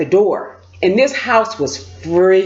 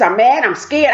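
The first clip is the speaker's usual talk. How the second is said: ordinary delivery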